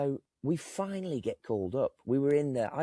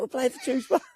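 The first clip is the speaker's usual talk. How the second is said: mock shouted insistence; 7 dB louder than usual. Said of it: "I was like".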